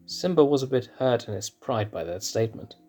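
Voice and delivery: ordinary delivery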